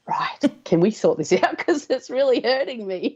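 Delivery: rising high on a mock whine; mock plea. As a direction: beg her half-jokingly to fix it. Pleading with retreat woman.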